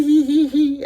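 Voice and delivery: high, mocking hee-hee titter; mock giggle. Mocking the gossiping secretaries with a high giggle. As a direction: tease them with a silly high giggle.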